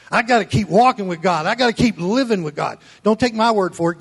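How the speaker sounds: loud, high, fired-up sermon delivery; full-force preaching. Acted. Pastor thundering at his church, loud preaching. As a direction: preach it hard to the congregation.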